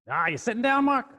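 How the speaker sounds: high, loud New York phone voice; New York accent. Retold or imitated. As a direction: insist excitedly as a New Yorker. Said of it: "this kinda New York accent".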